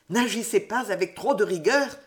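usual speaking voice

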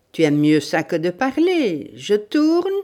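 loud, climbing menace; growled threat. Acted. Bandit to stubborn victim before hurting her, growling. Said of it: "grondait le bandit".

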